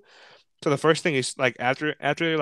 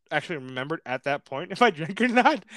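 normal talking voice

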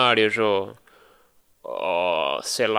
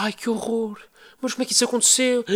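shocked friend; 9 semitones higher than usual. Retold or imitated.

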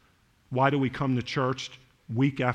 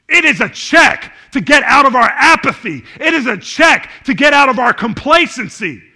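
loud, high preaching shout; sermon climax with slowed punchlines; preaching at full force; 10 semitones higher, 13 dB louder than usual. Acted. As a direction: drive it home to the congregation, shouting.

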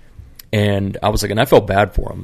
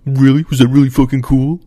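much higher, louder mocking sneer; sarcastic mock reply; 6 semitones higher, 7 dB louder than usual. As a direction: throw it back with exaggerated sarcasm. Mimicking hostile sarcastic reply.

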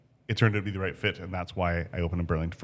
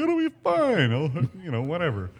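carefree; 9 semitones higher than usual. Retold or imitated. Retold own carefree thought.

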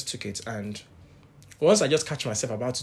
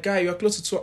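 retold alarmed shout; 7 semitones higher, 4 dB louder than usual. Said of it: "they were shouting".

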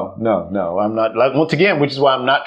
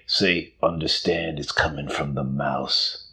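low, quiet mob-boss mouse; mouse as crime boss; 4 semitones lower, 5 dB quieter than usual. Acted. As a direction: give orders softly and low, like an offstage boss. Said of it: "I'm sure he gets off screen and goes".

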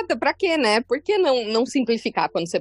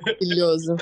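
ordinary talk

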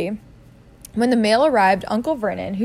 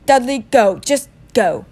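breathless shout; 3 semitones higher, 5 dB louder than usual. A narrator's reading. Breathless uncle after fight.

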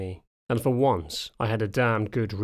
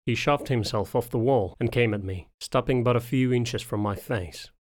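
ordinary delivery

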